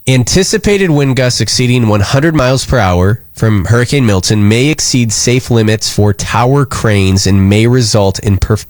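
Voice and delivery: ordinary talk, nothing marked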